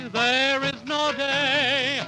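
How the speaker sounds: ordinary talk, nothing marked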